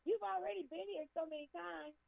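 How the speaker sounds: high-pitched, put-out complaint; mock attitude. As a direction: act fed up with a repeat visitor. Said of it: "don't have an attitude and say".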